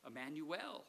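normal talking voice

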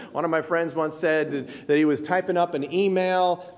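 ordinary talk, nothing marked

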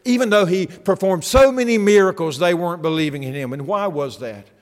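rising impassioned sermon climax; preaching at full force. Acted. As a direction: drive the point home with full voice.

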